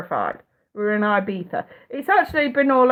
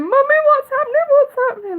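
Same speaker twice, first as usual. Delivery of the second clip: put-on high, loud frightened child's cry; scared child; 5 dB louder than usual. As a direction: call out like a terrified little kid. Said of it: "the kids are screaming".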